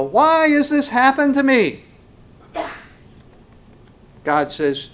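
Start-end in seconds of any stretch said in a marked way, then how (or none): 0.0-1.8 high, loud mock wail; mock lament of the complainer; whining complainer. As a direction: imitate a self-pitying cry. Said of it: "and they go".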